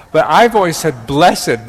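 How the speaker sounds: "I've always said blessed" with "blessed" as bless-ed; two-syllable blessed. Shown as a demonstration. Defending his old habit, "blessed" as bless-ed. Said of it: "that's my Wisconsin accent".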